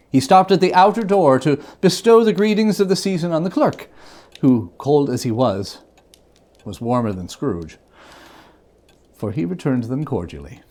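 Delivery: usual speaking voice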